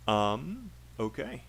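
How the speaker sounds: ordinary delivery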